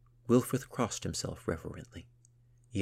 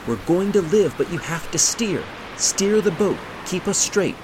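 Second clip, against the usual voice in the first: high, full-force commands; shouted urging; 12 semitones higher, 11 dB louder than usual. A narrator's reading. Gunhild urging her friend to steer, shouting. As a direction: drive each order home over the storm.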